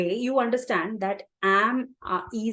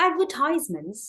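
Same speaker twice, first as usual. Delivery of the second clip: "Advertisements" with the stress on "tise"; American stress on TISE; 4 semitones higher, much slower than usual. Shown as a demonstration. Teacher showing learners American English, "Advertisements" stressed on "tise". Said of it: "the stress is on ties".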